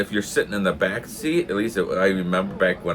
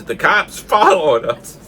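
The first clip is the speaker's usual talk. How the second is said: raised, louder mock yell; retold sudden yell; 4 semitones higher, 6 dB louder than usual. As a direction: call it out as a sudden warning, slightly held back. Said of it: "all of a sudden my brother yells".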